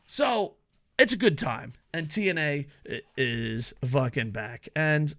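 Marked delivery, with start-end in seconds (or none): none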